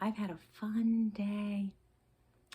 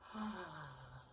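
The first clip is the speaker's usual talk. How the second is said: soft sigh; 3 semitones lower, 9 dB quieter than usual. Shown as a demonstration. Calming demo.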